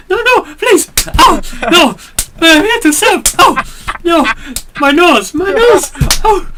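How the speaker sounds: overacted waiter getting punched; mock panic. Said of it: "It's like".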